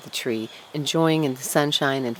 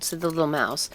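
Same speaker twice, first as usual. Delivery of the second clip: ordinary delivery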